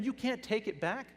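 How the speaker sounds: normal talking voice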